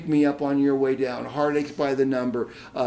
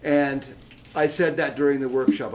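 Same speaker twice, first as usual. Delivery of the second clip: ordinary delivery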